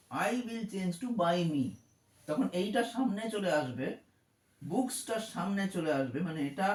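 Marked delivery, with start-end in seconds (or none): 0.1-1.6 spelled out very slowly for the class; slow dictation. Shown as a demonstration. Teacher slowly showing children the change. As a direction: say it slowly, word by word. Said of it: "By me হয়ে যাবে এটা".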